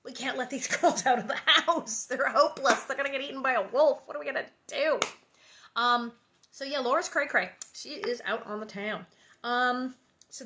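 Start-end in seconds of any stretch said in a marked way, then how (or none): 0.0-5.0 mock-despairing mother, louder; hammed-up fretting wife complaining to her husband; improvised Ma. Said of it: "Ma's probably going".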